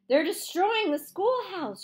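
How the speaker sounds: breathless, high and loud, slow; out of breath. A narrator's reading. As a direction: gasp it out after running. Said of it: "gasping for breath".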